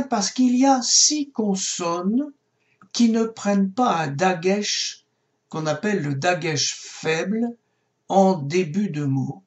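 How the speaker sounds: ordinary talk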